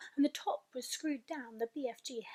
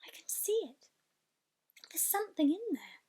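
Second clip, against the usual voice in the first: excited whisper; 5 semitones higher than usual. Acted. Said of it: "cried out".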